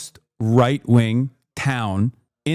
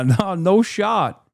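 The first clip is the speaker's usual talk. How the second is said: ordinary delivery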